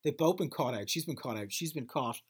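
normal talking voice

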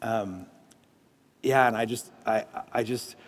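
soft prayer. Acted. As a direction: pray over them gently, pausing often.

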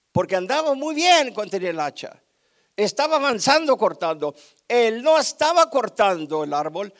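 loud, building preacher's insistence; forceful preaching. Real, not acted. Preacher explains the lost axe to church, forceful preaching. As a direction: drive each point home, peaking on the axe.

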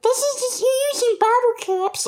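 very high inner-voice nag; very high critic voice nagging from the back of the mind. Retold or imitated. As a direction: play the little voice in your head. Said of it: "it's like".

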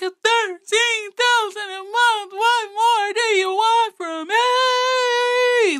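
mock wail, very high and drawn out. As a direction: imitate an over-the-top tearful plea, almost sung. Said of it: "when he was on there crying".

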